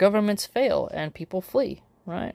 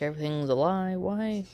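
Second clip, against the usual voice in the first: ordinary talk, nothing marked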